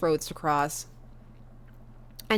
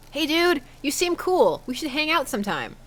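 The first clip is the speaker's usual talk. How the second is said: higher-pitched casual invitation; chirpy message; 7 semitones higher than usual. Acted. Dad messaging Damien to hang out, chirpy and high. Reads out typed friendly message.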